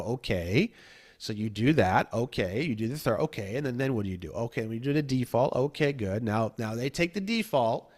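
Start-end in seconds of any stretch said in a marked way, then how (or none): 0.0-6.2 a patient coach coaxing step by step; patient questioning. Retold or imitated. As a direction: lower and softer, calmly walking them through. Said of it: "And then I go".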